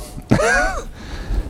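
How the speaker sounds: airy high laugh fading out; breathy laugh. Real, not acted. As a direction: let a soft laugh escape. Laughing at his own joke.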